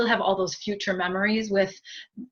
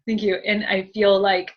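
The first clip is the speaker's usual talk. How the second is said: ordinary delivery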